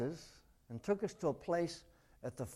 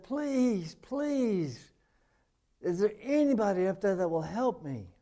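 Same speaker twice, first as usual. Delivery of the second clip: high, desperate begging; desperate pleading; 6 semitones higher, 5 dB louder than usual. Acted. Dangling man begs anyone up there, high desperate plea. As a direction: plead upward for your life.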